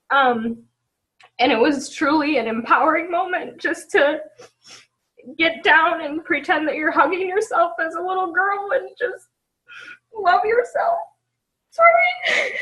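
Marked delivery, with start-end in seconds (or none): 1.4-12.5 much higher, breaking crying voice; words squeezed out between sobs; real sobbing, voice breaking high. Real, not acted. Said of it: "I'm gonna cry".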